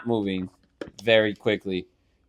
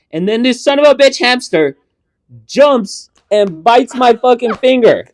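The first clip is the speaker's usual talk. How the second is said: higher, louder, dragged-out outrage; 10 semitones higher, 11 dB louder than usual. Real, not acted. Climax of retold bite.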